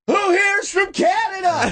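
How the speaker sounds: very high, slow shouted crowd call; onstage hype call. Retold or imitated. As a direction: holler to a big theater, stretched out. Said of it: "I walk out, I go".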